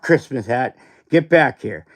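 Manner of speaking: ordinary talk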